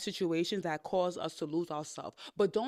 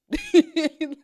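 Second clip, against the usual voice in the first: loud burst of laughter; 9 semitones higher, 13 dB louder than usual. Real, not acted. Laughing at her own joke.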